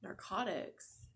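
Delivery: "Narcotics?" quiet, slow and incredulous; "Narcotics?" repeated back in soft disbelief; "Narcotics?" echoed quietly by a startled patient; stunned echo. Retold or imitated. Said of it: "when she said narcotics, I was just like".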